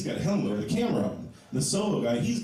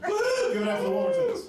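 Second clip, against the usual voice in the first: much higher, shouted like a game-show host; mock announcer hype. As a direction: whip up the crowd for the family. Hyping up his family in crowd.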